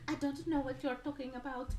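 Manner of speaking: high, put-on leading lady; bad Ingrid Bergman. Woman denies all to the man, bad Ingrid Bergman. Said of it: "with my bad Ingrid Bergman".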